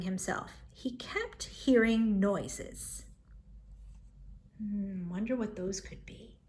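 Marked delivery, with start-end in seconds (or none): 4.6-6.4 hushed aside. Acted. Narrator aside on noises.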